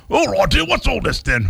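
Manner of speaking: high, hammed-up British sketch voice; mock an English sketch comic's indignation; Monty Python. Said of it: "that's Monty Python".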